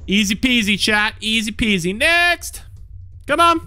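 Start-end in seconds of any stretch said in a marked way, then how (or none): 2.0-3.6 calling out. Acted. Calling next visitor.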